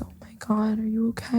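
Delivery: hushed, breathy whisper of concern. Retold or imitated. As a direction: whisper it like a worried friend. Friends' shocked reaction, whispered.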